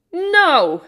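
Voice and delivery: sharp loud high refusal. Acted. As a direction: shout the refusal. Tin Man loud refusal.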